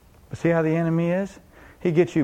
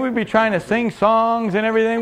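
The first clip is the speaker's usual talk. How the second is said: ordinary talk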